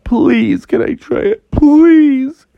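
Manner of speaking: high, whiny begging; cartoon kid pleading; pleading Froggy. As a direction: beg harder, loud on the last "please". Froggy begs dad.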